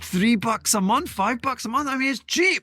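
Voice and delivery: high-pitched, worked-up begging; mock-desperate plea. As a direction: plead for support in a comically strained high voice. Mock-desperate plea for listener support.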